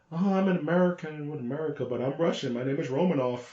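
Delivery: a lightly voiced, breezy fake spy. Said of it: "Scarlett just plays it so nonchalant".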